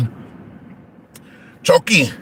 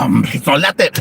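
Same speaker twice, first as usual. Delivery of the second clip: ordinary delivery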